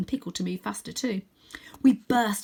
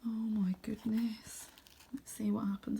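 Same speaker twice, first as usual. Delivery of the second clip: awed whisper; 10 dB quieter than usual. Real, not acted. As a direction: hush in suspense. Reader reacts in suspense.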